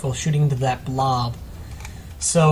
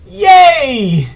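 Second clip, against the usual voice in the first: very high, loud mock cheer; sarcastic yay; 13 semitones higher, 12 dB louder than usual. Real, not acted. Caster cheering sarcastically about being sick. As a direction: squeal "Yay!" in ironic triumph.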